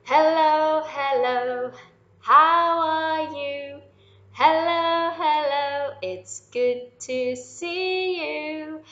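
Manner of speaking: big bright hello to little ones; cheery greeting. Real, not acted. Presenter greeting young listeners with loud cheer.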